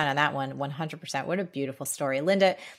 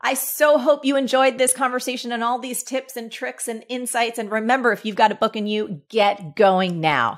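louder, higher upbeat sign-off; podcast outro; 5 semitones higher, 5 dB louder than usual. Acted. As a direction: send the listeners off with a pep talk. Host's closing outro.

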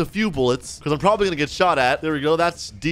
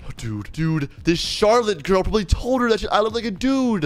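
ordinary talk, nothing marked

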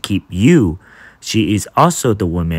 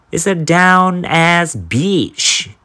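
slow punchline; 6 semitones higher, much slower than usual. Acted. Slow punchline reveal of definition.

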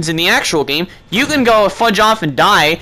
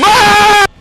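high, loud scream; startled scream; 12 semitones higher, 7 dB louder than usual. Real, not acted. Player caught by the animatronic, startled scream. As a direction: scream at a jump scare. Jump scare in horror game.

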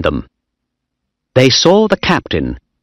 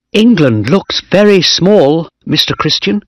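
put-on higher-pitched excited young officer; lightly excited boy's voice; happy, excited; 7 semitones higher than usual. A narrator's reading. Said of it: "he was happy and excited".

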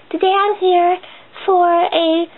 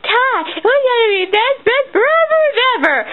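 high, swooping mock-movie voice; film character impression; 8 semitones higher than usual. Quoting a cartoon friendship scene, high and gushing. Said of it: "and she's like".

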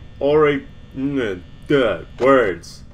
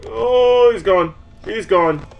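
loud, high burst of relief; high-pitched real exclamation; panicked relief; 10 semitones higher, 8 dB louder, slower than usual. Real, not acted. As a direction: cry out as the threat vanishes. Threat vanishes in horror game.

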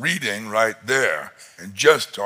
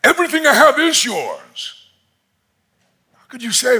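pleading father; 9 semitones higher, 6 dB louder than usual. Acted. Father pleading with his older son, high and anguished. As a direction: cry it out as a father who can't believe it. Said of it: "I can only imagine that father saying".

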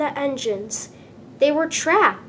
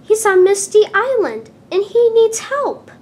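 much higher, louder urgent cry; urgent excited; 9 semitones higher, 8 dB louder than usual. A narrator's reading. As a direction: rush the news out, pleading for help. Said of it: "Percy peeped excitedly".

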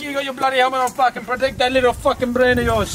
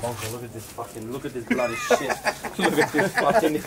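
laughing mockery; 6 semitones lower than usual. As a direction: laugh at him while you mock. Mocking laughing at helmet.

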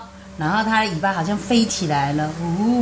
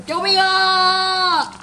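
high, slow mock cry for help; little brother wailing help; 11 semitones higher, much slower than usual. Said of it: "小弟就说".

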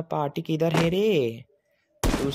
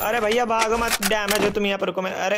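high-pitched, urgent gamer plea; excited mid-fight outcry; urgent calling out; 6 semitones higher than usual. Real, not acted. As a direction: call after someone running away. Urging a fleeing opponent to stop.

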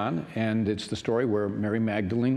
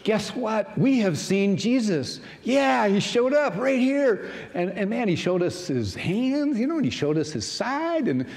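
much higher, louder gushing voice; excited disciples; 11 semitones higher, 4 dB louder than usual. Acted. Disciples tell Thomas they saw Jesus, high and excited. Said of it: "the disciples said".

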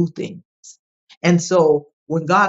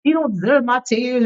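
higher-pitched, indignant retold protest; confused anger; 7 semitones higher than usual. Woman replaying her outburst at her own crying, confused anger. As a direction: question your own tears, angry and baffled. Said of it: "I was so confused and I was angry".

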